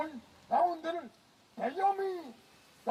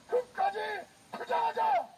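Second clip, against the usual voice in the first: roaring rallying speech; 7 semitones higher than usual. Real, not acted. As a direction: fire up the crowd. Leading crowd chant at rally.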